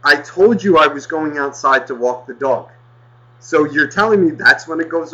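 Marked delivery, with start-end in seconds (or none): none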